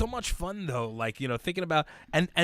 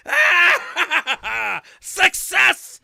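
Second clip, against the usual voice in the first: laughing shout of success, higher; laughing triumphant shout; 13 semitones higher, 9 dB louder, much slower than usual. Retold or imitated. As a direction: cheer it while laughing. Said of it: "And I was like".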